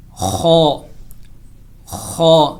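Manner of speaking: خو said thick and heavy from the upper throat; heavy kho. Shown as a demonstration. Teacher showing students how to say خو heavily. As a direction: give خو a full, heavy throaty sound. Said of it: "اس کو موٹا کر کے اس کو".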